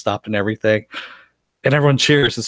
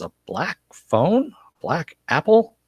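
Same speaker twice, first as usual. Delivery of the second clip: puzzled; 6 semitones higher, slower than usual. Retold or imitated. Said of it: "and he goes".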